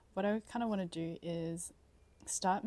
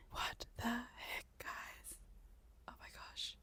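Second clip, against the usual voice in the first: slow, hushed, stunned whisper; awed whisper; 4 semitones higher, 9 dB quieter, slower than usual. Real, not acted. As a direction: whisper it in disbelief. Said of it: "I'm speechless".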